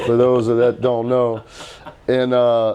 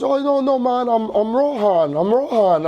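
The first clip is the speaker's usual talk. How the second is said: much higher Jamaican "I'm Rohan"; imitating Jamaican accent; 9 semitones higher than usual. Retelling Bob's son introducing himself, in a Jamaican accent. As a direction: correct him brightly in a Jamaican lilt. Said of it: "a Jamaican accent".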